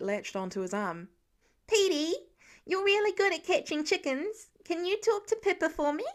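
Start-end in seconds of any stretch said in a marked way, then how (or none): 1.7-6.0 put-on high, hopeful child's voice; pleading little boy. A narrator's reading. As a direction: coax big Pete into helping. Voiced youngest son Nate.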